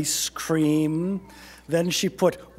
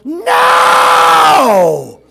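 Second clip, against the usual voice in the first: huge, long, high bellow; all-out yell; 11 semitones higher, 13 dB louder than usual. A narrator's reading. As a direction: holler it with everything you've got. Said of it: "all yelled".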